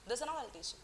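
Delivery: normal talking voice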